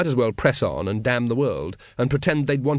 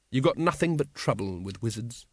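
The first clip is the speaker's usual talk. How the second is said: ordinary delivery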